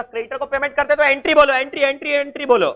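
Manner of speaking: teacher urging the class to speak; insistent demand. Real, not acted. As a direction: hammer on entry, entry.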